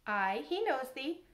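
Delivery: plain reading voice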